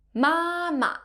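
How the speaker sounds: "Mama," held high and level; "Mama," like a sustained high note; first flat tone. Shown as a demonstration. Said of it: "which has the first flat tone".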